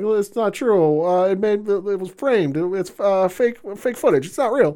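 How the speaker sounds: whiny flustered excuses; mock Eleanor pleading.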